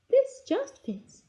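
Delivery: high, drawn-out delighted line; happy. A narrator's reading. Goldilocks finds the baby chair fits her, happily. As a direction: say it high and slow, pleased. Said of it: "she said happily".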